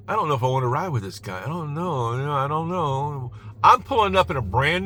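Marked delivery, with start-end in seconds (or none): 0.1-3.3 mock a nervous passenger, lightly voiced; hesitant rider. Wary rider getting in, fast uncertain muttering. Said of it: "they look at you and they go".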